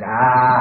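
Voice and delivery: put-on loud angry ah like a furious baby; sudden loud mock-infant ah; mad baby yell. Shown as a demonstration. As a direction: bark out a mock baby tantrum ah. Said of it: "When they get really mad, it goes".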